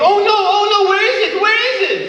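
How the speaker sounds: oh no, where is it, in a panic; panicked. Retold or imitated. Comic retelling his panic on the plane, high and frantic. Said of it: "And I'm thinking".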